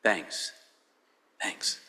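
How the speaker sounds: soft, deflated, sheepish thanks. Retold or imitated. Preacher answering her grad school friend, soft and deflated. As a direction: mutter your thanks, stung. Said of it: "I was like".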